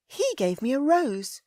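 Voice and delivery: high, loud proud lady; Ellie, haughty. A narrator's reading. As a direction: declare it with her chin up.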